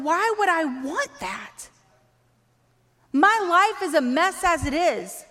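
high-pitched, put-on voice of an outsider; incredulous objection. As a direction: balk at an unwanted offer. Acting unconvinced outsider's objection.

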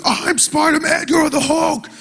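put-on high excited child shouting; excited kid shout. Father imitating his son in play fight, high excited shout. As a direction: shout like a little boy mid-fight. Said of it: "He's like".

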